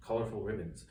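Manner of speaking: ordinary delivery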